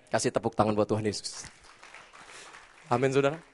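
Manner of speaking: normal talking voice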